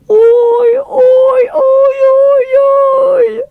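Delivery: high, loud wailing sobs; terrified girl sobbing. A narrator's reading. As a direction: sob each ouïe in fear. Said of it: "sanglota Lina".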